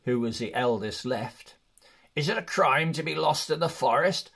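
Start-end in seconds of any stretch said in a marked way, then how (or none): 2.1-4.3 Balin protesting. Acted. Balin protesting to the elven king, in a raised indignant voice. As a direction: plead your case to the king, a touch of outrage. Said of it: "said Balin".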